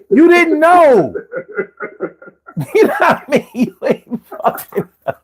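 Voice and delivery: talking through laughter; cracking up mid-sentence; laughing. Real, not acted. Laughing at the eggs story.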